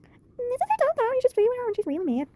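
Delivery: high, bright-toned put-on voice of a friend; Peyton voice. Friend rushes to the police station, high Peyton voice. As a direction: pitch way up to play Peyton fussing over her friend. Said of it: "I like to do her voice".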